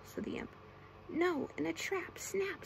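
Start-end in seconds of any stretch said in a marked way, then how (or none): 1.1-2.6 high, slow scallywag objection; high storybook creature voice. A narrator's reading. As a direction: pitch it up and draw it out. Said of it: "said the scallywag".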